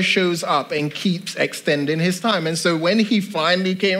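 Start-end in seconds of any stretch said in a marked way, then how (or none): none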